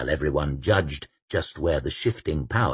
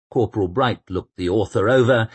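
usual speaking voice

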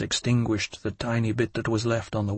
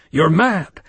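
higher, louder alarmed cry; alarmed shout; 5 semitones higher, 6 dB louder than usual. A narrator's reading. Woman stops Louis at the door, alarmed shout. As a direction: shout that he's mad.

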